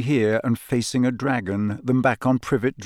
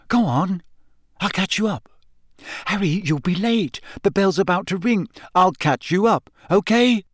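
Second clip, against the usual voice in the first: higher-pitched, hurried urging; 7 semitones higher than usual. A narrator's reading. Harry sending Hermione on ahead, urging. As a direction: wave a friend on insistently. Harry hurrying his friend off.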